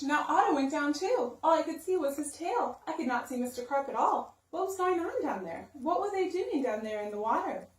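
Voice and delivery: ordinary delivery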